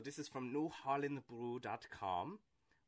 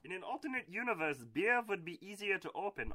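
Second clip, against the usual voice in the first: high put-on silly voice; annoying voice; 10 semitones higher, 5 dB louder than usual. Host opening a beer in annoying voice. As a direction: keep the grating goofy voice going. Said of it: "the annoying voice".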